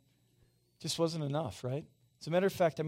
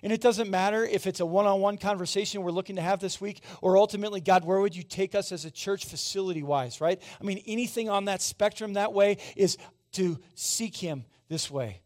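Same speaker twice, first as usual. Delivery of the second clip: loud, high, driving sermon push; full-force preaching; 5 semitones higher, 7 dB louder than usual. Acted. Pastor urging the church to seek him, preaching loud.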